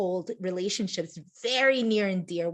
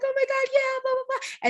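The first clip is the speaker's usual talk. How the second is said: very high-pitched eager agreement; imitated gushing reply; 3 dB louder than usual. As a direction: mimic an overexcited new friend. Re-enacts friend's gushing reply.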